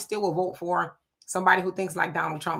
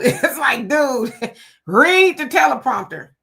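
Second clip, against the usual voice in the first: higher, louder, laughing through the words; laughing exasperation; 5 semitones higher, 6 dB louder, slower than usual. Real, not acted. Host scolds the mimicked president over the teleprompter, laughing exasperation. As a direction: break into laughter while pleading in mock exasperation.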